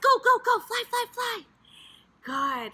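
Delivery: usual speaking voice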